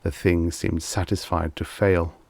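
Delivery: plain reading voice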